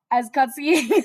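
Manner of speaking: breaking into laughter; laughing. Real, not acted. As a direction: laugh through the line. Laughing then whispering.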